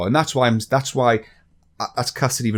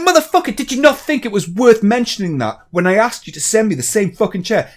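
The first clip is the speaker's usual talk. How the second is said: much higher, louder, exasperated yell at the seller; furious outburst; 9 semitones higher, 5 dB louder than usual. Retold or imitated. Ranting at the chair seller, loud furious yell. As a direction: blow up in disbelief at him. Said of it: "I'm like".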